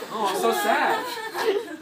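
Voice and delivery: mock lament, laughing, slow and loud; mock sad. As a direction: fake a pitiful sob while cracking up. Mock whining newlywed husband.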